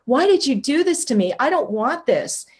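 mock-anguished plea, slowing at the end; retold tearful protest. Protesting to friend about the awakening, tearfully retold. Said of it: "I started crying again, just bawling".

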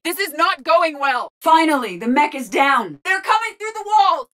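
high-pitched cries over combat; alarmed battle shouts. Acted. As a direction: call out as the fight worsens. Battle going badly.

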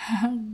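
small relieved giggle; a light pleased laugh; quiet satisfied chuckle; soft laugh. Acted. Laughs at his answer.

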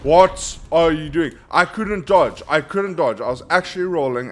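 loud, high, furious complaint at the game; frustrated yell. Real, not acted. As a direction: shout it out because the dodge failed. Gamer yelling at failed dodge.

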